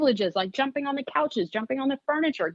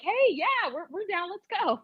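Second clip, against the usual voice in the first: higher, lightly voiced eager dogs; 9 semitones higher than usual. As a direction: play the dogs keen to go. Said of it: "They were like".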